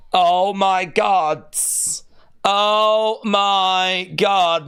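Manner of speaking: ordinary delivery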